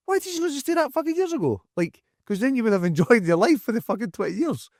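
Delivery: high-pitched disbelief, nearly squeaking; incredulous. Retold or imitated. To people who waited till retirement, incredulous high squeak. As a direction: throw up your hands, why not years ago.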